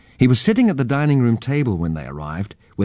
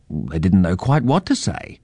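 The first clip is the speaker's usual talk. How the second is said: plain reading voice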